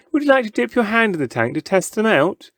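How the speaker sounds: teasing showman. A narrator's reading. Showman teases crowd.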